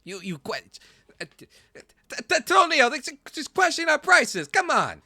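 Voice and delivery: high, loud stammering merchant voice; flustered shopkeeper. Acted. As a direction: stutter out an offended sales pitch. Shopkeeper stuttering at customer.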